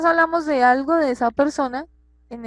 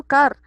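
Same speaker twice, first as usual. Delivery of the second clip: "Car" punched louder on its own; stressed syllable; 2 semitones higher, 6 dB louder than usual. Shown as a demonstration. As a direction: hit "Car" hard as the strong syllable. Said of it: "Buscar tiene más fuerza que bus".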